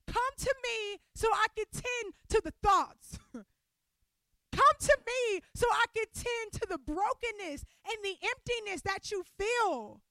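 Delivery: swelling pulpit cry; building preaching. Real, not acted. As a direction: preach it up to a peak.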